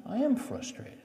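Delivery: high-pitched but softly spoken exasperation; frustrated admission. Retold or imitated. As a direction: admit it to your wife, worked up. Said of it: "You, you just look so frustrated".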